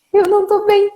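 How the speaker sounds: alarmed retold plea; urgent plea. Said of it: "E eu falei".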